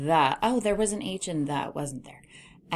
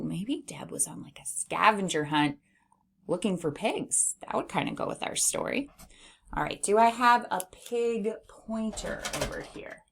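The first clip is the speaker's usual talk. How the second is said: ordinary talk, nothing marked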